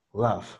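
laugh, A pulled toward E. Shown as a demonstration. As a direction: push the vowel in laugh toward E. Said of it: "Un poquito más de E".

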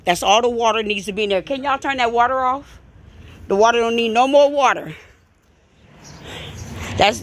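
ordinary talk, nothing marked

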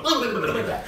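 high loud mouth gunfire; gunfire noises. Retold or imitated. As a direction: rattle off shots with your mouth. Acting out the gunfight.

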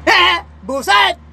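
hammed-up exasperated shout; angry outburst. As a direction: blurt it out like an offended cop. Policeman reacts to the insult.

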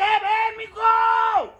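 high, slow drawn-out shouts; calling out. Real, not acted. Calling a friend to come down, slow shouts. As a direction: coax him down, stretching the name.

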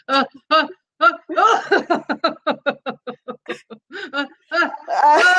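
building uh-uh into a sneezing laugh; sneeze laugh. Shown as a demonstration. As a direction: wind up like a sneeze, then laugh. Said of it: "Or you can sneeze".

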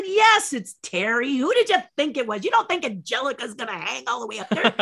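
high, loud, impatient retort; exasperated scolding. Retold or imitated. Stunt double sets the nervous star straight, exasperated and loud. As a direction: tell him off in mock exasperation.

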